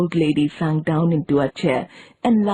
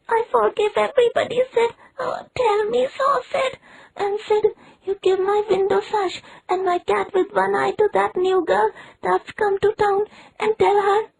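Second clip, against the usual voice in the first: high, quick, melodramatic forgiveness; hammed-up deathbed boy. A narrator's reading. Tom to Sid, high mock-dying farewell. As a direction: play the martyr to the hilt.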